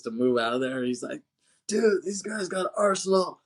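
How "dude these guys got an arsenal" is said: higher-pitched urgent alert; mock urgent warning. Keanu warning Pappas on the walkie, mock urgent. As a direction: warn your buddy, acting it out.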